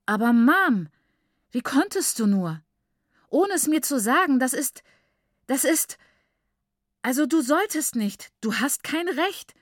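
high, loud, outraged protest; indignant protest. A narrator's reading. Daughter confronts her mother who took the book, indignant. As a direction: sputter with indignation, voice pushed up high.

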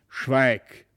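mildly angry, low-key command; firm, cross order to be silent; angry call. A narrator's reading. Father silencing his son, mildly angry. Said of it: "rief der Herr von Brakel ganz erzürnt".

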